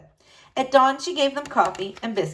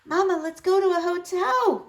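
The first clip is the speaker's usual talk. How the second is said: eager cry; 10 semitones higher than usual. A narrator's reading. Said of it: "Clara cried".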